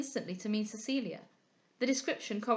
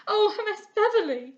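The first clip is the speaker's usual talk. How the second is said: anguished cry; 11 semitones higher, 8 dB louder than usual. A narrator's reading. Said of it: "cried Henrietta".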